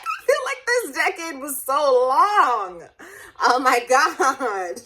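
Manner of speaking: real tearful laugh as the decade ends; laughing through tears. Real, not acted. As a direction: let the laugh spill over while your eyes water. Laughing through tears at turning thirty.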